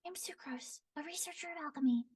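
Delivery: high, soft, fast and airy; Sucrose's voice. Shown as a demonstration. Voice actor demoing a nervous alchemist girl, high and quick. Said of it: "very quick, almost nervous".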